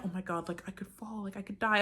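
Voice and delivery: hushed mock-scared gasp at the drop. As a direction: breathe it like the fall could really kill you. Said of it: "I wasn't like".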